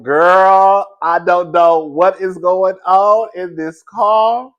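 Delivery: loud, slow, exasperated; loud exasperated girl. Retold or imitated. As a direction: drag it out loud in disbelief. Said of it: "I'm just like".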